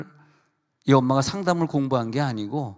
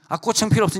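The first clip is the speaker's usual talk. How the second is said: daughter pitched higher, louder, waving it off; mock-indignant daughter, higher and louder; high, insistent refusal; 9 semitones higher, 4 dB louder, faster than usual. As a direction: brush off mom's offer up high.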